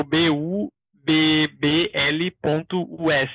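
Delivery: slow, letter-by-letter spelling; each letter given its own slow beat; spelled out. Shown as a demonstration. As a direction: spell out B U B B L ponto U S slowly. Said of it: "não sei como pronunciar esse negócio".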